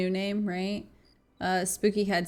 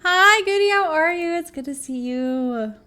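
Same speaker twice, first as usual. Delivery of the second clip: high, loud bright hello; excited greeting; 8 semitones higher, 7 dB louder than usual. Real, not acted. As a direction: greet a friend with delight.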